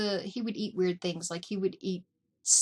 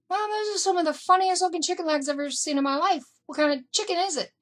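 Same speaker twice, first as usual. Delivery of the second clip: higher, louder and fast disbelief; high, fast, louder mock outrage; incredulous; 9 semitones higher, 4 dB louder, faster than usual. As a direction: rattle it off, amazed at the food. Retold incredulous reply to mom.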